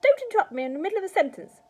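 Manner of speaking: high, fast, loud grandma outburst; shouted. A narrator's reading. Grandma scolding George for interrupting, shouted. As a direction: shout it as a cross old woman cutting someone short. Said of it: "she shouted".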